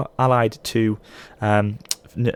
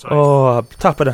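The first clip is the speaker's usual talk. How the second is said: mock Scottish slipping into Irish, pitched up; accidental Irish accent; 4 semitones higher, 4 dB louder than usual. Gamer attempting a Scot for the game's envoy, landing in a mock Irish accent. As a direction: start a stock Irish greeting, raised and hammed-up. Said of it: "but again, that's Irish".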